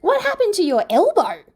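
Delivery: high, loud alarmed question. A narrator's reading. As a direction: cry out at the injury. Shocked at injured arm.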